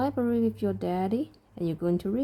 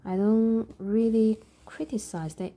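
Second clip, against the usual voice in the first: ordinary talk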